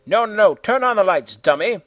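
annoyed shout. A narrator's reading. Scolding in the dark.

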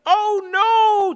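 very high, loud cry; panicked yell. A narrator's reading. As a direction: shriek in horror. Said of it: "Thomas Edison yelled".

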